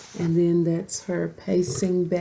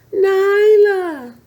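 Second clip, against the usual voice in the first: much higher, loud yell; 11 dB louder than usual. A narrator's reading. Simba yelling for his lost friend, high and loud. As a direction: yell her name to the cliffs. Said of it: "Simba yelled".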